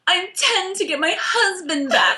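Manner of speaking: mock heroine, laughing; Claire. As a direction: overact a badly written dramatic line. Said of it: "And she says".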